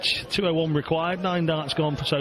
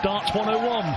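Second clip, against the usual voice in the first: excited; 7 semitones higher than usual. Real, not acted. Commentator excited call.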